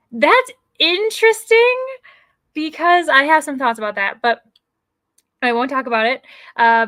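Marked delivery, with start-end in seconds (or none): 0.1-4.2 loaded "interesting". Acted. Host reacting to the book vote with pointed, knowing emphasis. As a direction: say it like you're holding back strong opinions.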